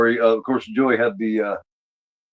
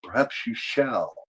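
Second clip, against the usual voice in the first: slow, soft and knowing, a bit quieter; sly Count reply; 7 dB quieter, slower than usual. Retold or imitated. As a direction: let it hang as a quiet veiled threat. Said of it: "And the Count says".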